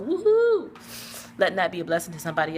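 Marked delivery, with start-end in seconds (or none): 0.0-0.7 high, drawn-out whoop; excited whoop; celebrating. Real, not acted. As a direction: cheer for the good news. Celebrating the mattress giveaway.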